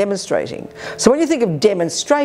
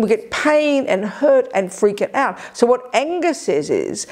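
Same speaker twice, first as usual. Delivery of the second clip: usual speaking voice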